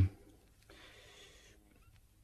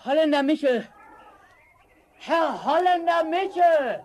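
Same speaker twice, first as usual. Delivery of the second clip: higher, loud calls, still out of breath; breathless calling out; 5 dB louder than usual. Acted. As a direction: call his name into the forest, panting. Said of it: "Noch außer Atem rief er".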